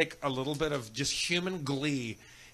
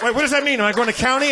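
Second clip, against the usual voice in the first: much higher and louder, laughing through the retold question; laughing retold panic; 9 semitones higher, 11 dB louder, faster than usual. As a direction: replay your own panic while cracking up. Retelling own panicked question, laughing.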